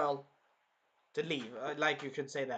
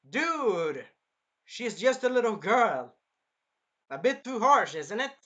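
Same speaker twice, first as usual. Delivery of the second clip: exasperated scolding; 4 semitones higher, 7 dB louder, slower than usual. Real, not acted. Scolding the hero.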